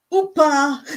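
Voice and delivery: Upa said high and bright, stress on the first syllable; Cypriot upa. Shown as a demonstration. Teaching viewers the Cypriot word, calling Upa with stress on U. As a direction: call out Upa like inviting someone to dance. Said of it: "the first one is upa".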